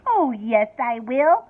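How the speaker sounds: ordinary delivery, nothing marked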